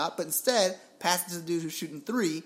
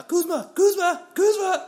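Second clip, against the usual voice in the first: full-throated yell, much higher and louder; screaming a name; 14 semitones higher, 10 dB louder, slower than usual. Retold or imitated. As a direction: scream the name as you sprint past everyone. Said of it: "while screaming".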